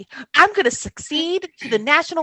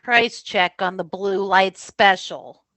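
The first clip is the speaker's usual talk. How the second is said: leaning into mic; 8 semitones lower, 6 dB quieter than usual. Acted. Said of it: "She leans into the microphone".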